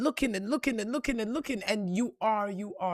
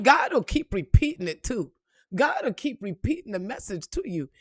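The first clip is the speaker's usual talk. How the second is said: ordinary talk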